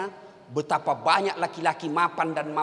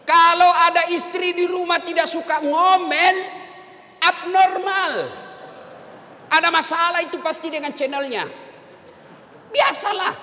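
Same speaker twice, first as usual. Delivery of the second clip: loud, higher sermon delivery; preaching at full force; 10 semitones higher, 5 dB louder than usual. Acted. Ustaz preaching to husbands about nagging wives, full force. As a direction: preach it out to the whole hall.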